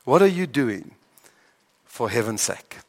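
low question fading very soft; quiet, searching challenge; changed tone of voice. Shown as a demonstration. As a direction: ask it gently, letting the last words drop. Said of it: "change your tone of voice".

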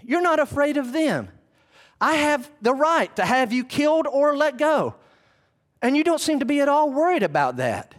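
high-pitched, building incredulity; Pilate, mind spinning. Acted. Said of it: "I'm feeling Pilate, his mind spinning".

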